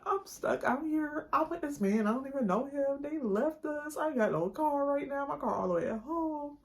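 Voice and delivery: a retold, hammed-up panic plea for help; frantic plea. Begging Kevin for a ride, high frantic pleading.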